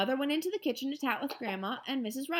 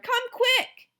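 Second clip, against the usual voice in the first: much higher loud urgent call; calling mom in from the kitchen; urgent shout; 14 semitones higher, 7 dB louder than usual. Acted. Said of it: "I hollered".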